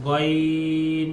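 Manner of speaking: গইন drawn out with rounded lips; rounded mouth. Shown as a demonstration. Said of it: "এখানে মুখটা গোল হবে". (গইন ধীরে, মুখ গোল করে)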